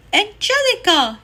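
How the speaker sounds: high, loud alarmed yell of a name. A narrator's reading. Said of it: "yelled Dee Dee and Chus".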